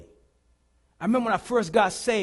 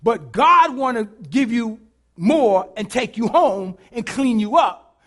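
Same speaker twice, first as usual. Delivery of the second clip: louder, slow preaching close; 4 semitones higher, 12 dB louder than usual. Acted. Preacher applying the lesson to the congregation, loud. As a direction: drive the lesson home to the church.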